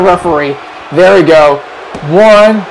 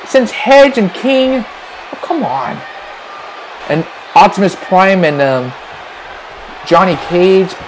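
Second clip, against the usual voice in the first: usual speaking voice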